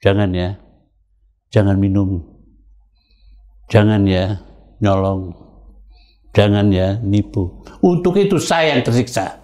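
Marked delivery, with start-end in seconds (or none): 0.0-7.6 low, measured big-brother warning; spaced-out fatherly advice, pitched low; retold admonition. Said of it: "Nah, aku bilang". (menirukan nasihat kakak, bernada rendah)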